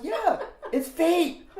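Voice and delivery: high, slow laughing reveal; imitating laughing friend. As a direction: drag it out giggling.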